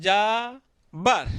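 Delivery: "যাবার" broken in two, a long held যা, then বার; split into syllables. Shown as a demonstration. Teacher showing students the word's parts, "যাবার" drawn out syllable by syllable. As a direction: stretch "যাবার" slowly as যা, pause, বার. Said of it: "দুইবার লেগেছে কিন্তু".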